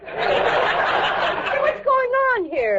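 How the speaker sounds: high, startled cry. Acted. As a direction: react in alarm. Maid startled under cans.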